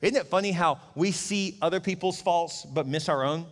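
normal talking voice

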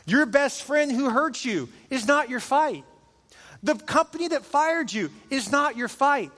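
high, slow, emphatic sermon delivery; impassioned preaching. Real, not acted. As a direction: drive the point home to a congregation. Preaches the point at full force.

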